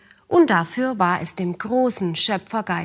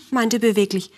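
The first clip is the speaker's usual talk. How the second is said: plain reading voice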